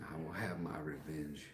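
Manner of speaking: very soft, low-key movie line; hushed film quote. Acted. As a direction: quote it quietly, as if to yourself.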